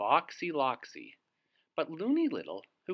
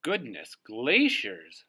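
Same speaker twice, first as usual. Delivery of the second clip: slow, loud mock squawk; a little bird's exclamation, drawn out; lightly squawked exclamation; 8 dB louder, slower than usual. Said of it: "squawked Dovekie Lovekie".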